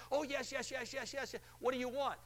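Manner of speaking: high, breathless yes yes yes; eager caller. Retold or imitated. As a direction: rush it like a thrilled winner. Acted excited caller.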